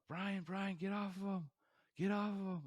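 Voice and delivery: high, loud alarmed shout, retold; imitating the panicked teacher yelling at the boy. Teacher yells at student, retold.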